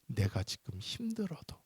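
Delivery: soft unvoiced whisper; hushed confession to the congregation. Real, not acted. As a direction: breathe it intimately. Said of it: "whispered line".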